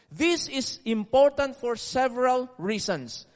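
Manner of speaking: usual speaking voice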